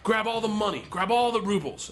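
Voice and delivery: high, pushy mock order; imitated urgent command. Gangster ordering the student to rob the bar, imitated urgent command. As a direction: egg on an accomplice mid-heist.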